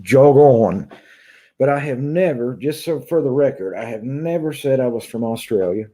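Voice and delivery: ordinary talk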